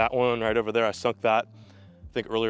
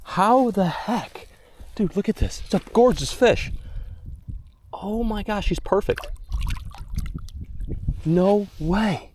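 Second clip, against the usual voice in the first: high, stretched-out amazement; real high-pitched wonder; awestruck; 11 semitones higher, slower than usual. Real, not acted. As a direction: marvel at the fish, voice pitched way up. Marvels at the caught fish.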